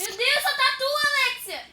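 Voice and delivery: shouting. Real, not acted. Shouting to friend.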